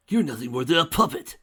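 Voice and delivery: loud, high yell. Acted. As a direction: yell it in fury. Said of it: "yelled Spike".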